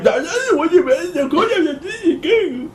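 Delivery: high, giggly mock clerk; laughing desk clerk. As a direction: imitate someone laughing through every word. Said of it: "The motherfucker was laughing about it".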